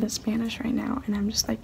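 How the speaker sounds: hushed whisper in a bathroom; whispering while hiding. Real, not acted. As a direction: keep it to a secret whisper.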